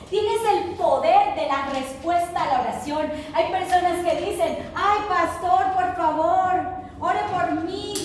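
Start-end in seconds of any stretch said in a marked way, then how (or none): 4.7-7.9 pleading church member. Retold or imitated. Church member begging pastor.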